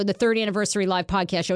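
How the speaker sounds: usual speaking voice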